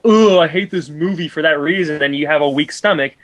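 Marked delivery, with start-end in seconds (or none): 0.1-2.0 a whiny, disgusted groan at the film; mock whiny complaint. Host mocking the critic as a squeamish viewer, whiny. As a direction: mimic a squeamish viewer who hates it. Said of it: "if you're gonna be all".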